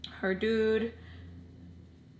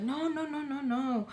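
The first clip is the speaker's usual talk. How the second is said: higher-pitched string of protests; mock protesting, pitched up; acted refusal; 6 semitones higher than usual. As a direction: imitate someone refusing over and over. Acting out his refusal.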